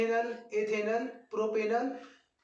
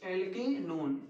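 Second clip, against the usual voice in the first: dictating name; 2 semitones lower, 4 dB quieter, much slower than usual. Shown as a demonstration.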